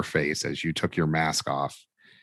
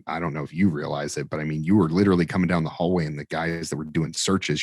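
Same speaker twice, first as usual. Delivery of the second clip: ordinary talk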